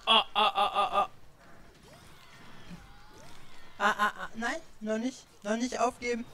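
high loud panicked cries; panic, then plead; panicking gamer. Real, not acted. Near death in game.

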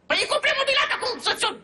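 high, squeaky protest; exasperated. Acted. Mayor balking at handing over the furnished flat, exasperated. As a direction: voice shoots far up in exasperation.

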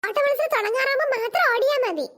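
ordinary delivery

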